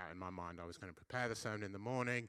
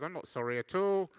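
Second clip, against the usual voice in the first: mock defiant; 6 semitones higher, 8 dB louder than usual. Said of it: "You said".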